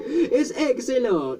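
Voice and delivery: high, slow, laughing cheer; excited. Real, not acted. Puzzle result shown.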